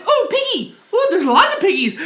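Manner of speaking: high delighted coo at the pigs; mock excited baby talk; cooing excitedly. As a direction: squeal at the piggies like a child. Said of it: "She's like".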